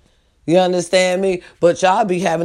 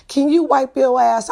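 incredulous question; 7 semitones higher than usual. Real, not acted.

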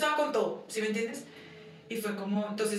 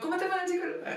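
high-pitched put-on housewife greeting; 10 semitones higher, faster than usual. Wife greeting her partner back from the psychologist, mock housewife voice. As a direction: play the housewife in a much higher voice. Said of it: "Así la ama de casa".

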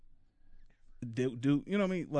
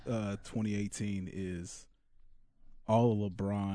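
lower, softer sultry delivery; sexy voice; 4 semitones lower, 5 dB quieter than usual. Acted. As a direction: ease it out slowly, seductive. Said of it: "Sexy voice back".

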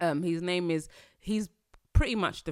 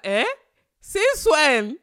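loud, higher, stunned "since when?"; shocked disbelief; 10 semitones higher, 12 dB louder, slower than usual. Retold or imitated. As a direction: blurt it out in sheer disbelief. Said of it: "I was like".

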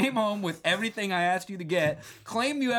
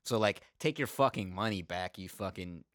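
lower, softer, smug put-down; arrogant Joseph; 8 semitones lower, 5 dB quieter than usual. Retold or imitated. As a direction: sneer it quietly from far above them. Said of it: "he's basically being like".

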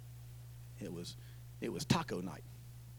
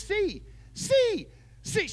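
high shouted "Sí, sí, sí", much louder; emphatic repeated "Sí"; 9 dB louder, much slower than usual. Retold or imitated. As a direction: hammer each "sí" harder, childishly. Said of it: "more and more emphatic".

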